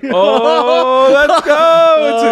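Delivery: loud, high cheer; excited cheer. Real, not acted. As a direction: shout it out with joy. Cheering the after party plan.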